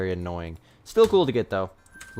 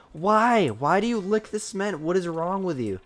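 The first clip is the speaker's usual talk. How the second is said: exasperated scolding; 7 semitones higher than usual. Real, not acted. Scolding dog for licking cement.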